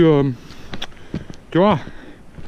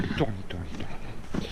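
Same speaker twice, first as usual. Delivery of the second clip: slow, much softer and lower; hushed; 9 semitones lower, 12 dB quieter than usual. Real, not acted. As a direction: let it drop to a hush. Hushed trailing repetition.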